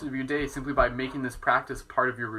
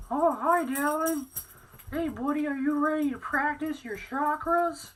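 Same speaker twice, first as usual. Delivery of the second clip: much higher sing-song puppy voice. Real, not acted. Owner greeting her dog Dallin in baby talk.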